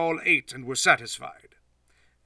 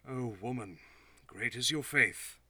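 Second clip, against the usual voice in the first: much softer, gentle approval; tender praise; 11 dB quieter than usual. Acted. Jesus tenderly praises the woman's faith. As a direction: say it softly, moved by her faith.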